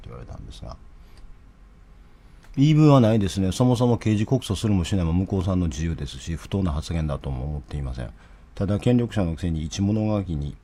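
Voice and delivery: quiet murmured read-through of a written statement; low soft drafting voice as if checking text; reading under breath. Real, not acted. Reading his press comment to himself, softly under breath.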